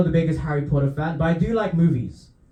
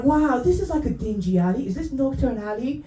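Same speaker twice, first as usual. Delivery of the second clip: higher, awed tourist voice; lightly voiced starstruck tourist; wide-eyed American tourist; 7 semitones higher, 4 dB quieter than usual. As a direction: gush like a gullible American fan. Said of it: "They're like".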